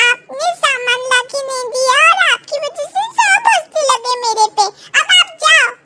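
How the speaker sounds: put-on an upset boy blaming his father; sulky child. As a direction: son scolded at school blaming father. Narrator voices child Sonu.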